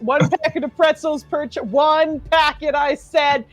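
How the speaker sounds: strict teacher. Pretend. GM mocks a strict trip teacher.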